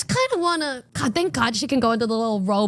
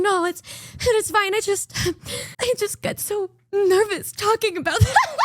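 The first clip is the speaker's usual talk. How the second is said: imitating a shy nervous friend, higher with a laugh; 6 semitones higher than usual. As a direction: mimic her bashful giggle while she admits it. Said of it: "She said".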